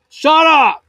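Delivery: sudden high yell at the bird; real shout. Real, not acted. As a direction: snap loudly at an annoying bird. Yelling at a noisy bird.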